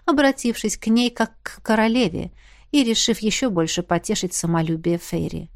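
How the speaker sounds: usual speaking voice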